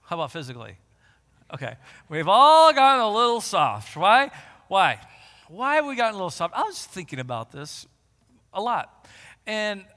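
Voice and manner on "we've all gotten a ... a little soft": shouted, emphatic challenge; loud preaching. Acted. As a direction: call the church out hard. Preaching loudly.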